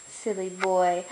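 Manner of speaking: normal talking voice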